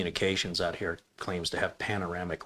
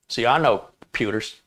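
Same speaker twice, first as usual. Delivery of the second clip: normal talking voice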